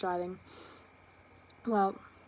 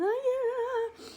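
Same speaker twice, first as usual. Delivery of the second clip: mock scream; 4 dB louder than usual. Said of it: "I was screaming".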